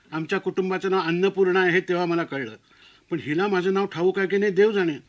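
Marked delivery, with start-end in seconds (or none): none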